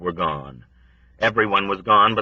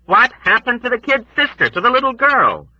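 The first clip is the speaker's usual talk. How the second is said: high, louder insistent question; raised voice; 5 dB louder than usual. A narrator's reading. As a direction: raise your voice to demand an answer. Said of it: "He raised his voice".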